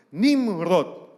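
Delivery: loud proud Nimrod; mock proud father. Father repeats his son's name, loud and proud.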